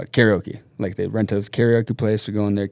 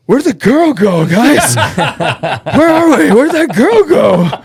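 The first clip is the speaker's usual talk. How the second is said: put-on high, loud, slow drunk voice with laughs; hammed-up drunk, laughing; clueless drunk; 13 semitones higher, 11 dB louder, slower than usual. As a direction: play the oblivious wasted friend. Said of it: "Austin's just all".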